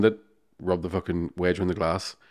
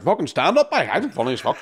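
put-on drunk boast; 6 semitones higher than usual. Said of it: "I was like".

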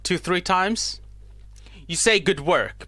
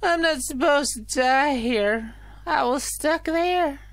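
lightly higher NPC voice; higher character voice; 6 semitones higher than usual. Acted.

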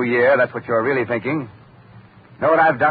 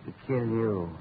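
much softer, deliberate threat; quiet menace; 3 semitones lower, 10 dB quieter than usual. Acted. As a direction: say it softly and let it land. Killer states his ambition.

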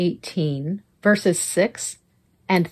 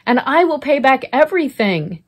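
higher, louder pleading servant; begging; 6 semitones higher, 5 dB louder than usual. A narrator's reading. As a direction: plead to be spared. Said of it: "he begged".

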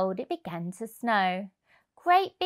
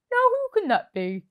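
put-on higher, wondering old man Percy; surprised Percy; 12 semitones higher, 4 dB louder than usual. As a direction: puzzle over who is knocking.

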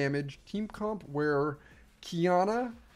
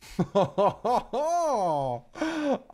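excited laugh; 8 semitones higher, 3 dB louder than usual. Real, not acted. Overexcited streamer.

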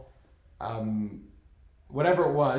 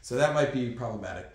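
ordinary talk

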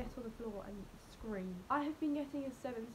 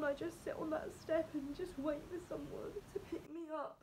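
higher, teary voice throughout; tearful; 5 semitones higher than usual. Real, not acted. Student crying, vowing to pick herself up. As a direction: talk through tears with resolve.

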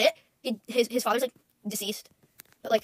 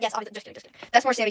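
normal talking voice

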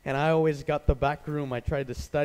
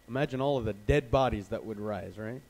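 ordinary talk, nothing marked

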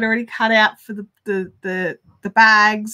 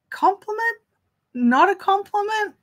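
much higher, baffled question; mock confusion, pitched up; puzzled; 9 semitones higher than usual. Asking mum whether that was praise, mock baffled. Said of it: "And I was just like".

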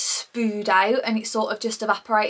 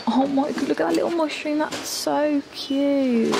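higher, delighted squeal over something cute; gushing excited; 5 semitones higher than usual. Real, not acted. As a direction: gush over it like it's adorable. Gushing at mushroom decor.

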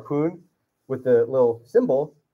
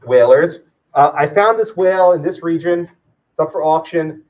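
raised voice, asking round the whalers; calling out; 3 semitones higher, 9 dB louder, slower than usual. Acted. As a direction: put a question loudly to a crowd. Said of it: "You could have asked around, said".

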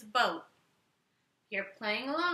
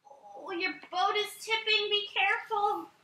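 higher, urgent warning; alarmed; 8 semitones higher than usual. Acted. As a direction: warn them the boat tips. Boat tipping, alarmed.

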